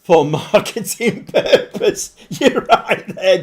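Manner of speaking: laughing through the words, slowed down. Real, not acted. Streamer laughing at a chat comment about marketing. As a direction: crack up while reading the comment. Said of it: "I don't know why I'm laughing".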